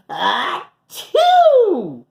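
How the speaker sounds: loud high mock sneeze; huge sneeze. The bear in his den, a giant mock sneeze. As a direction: blow out a giant achoo.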